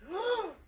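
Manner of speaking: high, overdone shocked gasp; dramatic gasp. Acted. Ricky hearing the confession, gasping theatrically. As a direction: gasp as if scandalized. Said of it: "overly dramatic gasp".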